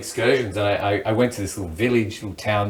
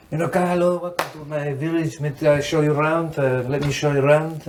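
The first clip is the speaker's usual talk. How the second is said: higher-pitched Moroccan accent, imitating a village guide; imitated Moroccan accent; 5 semitones higher than usual. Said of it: "I can do the accent".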